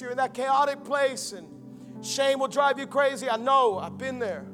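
usual speaking voice

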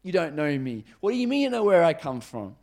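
confused protest. Acted. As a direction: demand an explanation in disbelief. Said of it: "you'd be pretty confused as well".

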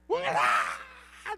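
¡ah! muy grave; grito grave. Shown as a demonstration. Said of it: "creo que esto se va a quedar cortito". (demuestra el grito con un ¡ah! muy grave)

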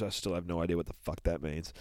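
normal talking voice

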